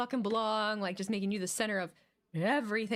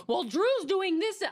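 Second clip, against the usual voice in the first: mocking fans; 7 semitones higher, 4 dB louder, slower than usual. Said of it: "they're like".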